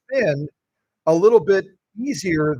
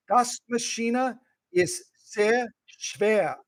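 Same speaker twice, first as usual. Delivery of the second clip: slow German; 4 semitones higher, much slower than usual. Shown as a demonstration. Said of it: "Now you can speak German".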